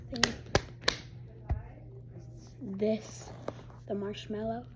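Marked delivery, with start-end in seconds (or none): 2.6-4.6 whisper. Real, not acted.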